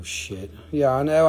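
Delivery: usual speaking voice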